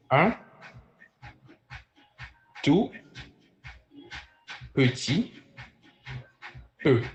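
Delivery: each word alone with long pauses; isolated words, widely spaced. Shown as a demonstration. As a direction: dictate it one word at a time. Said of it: "Like this".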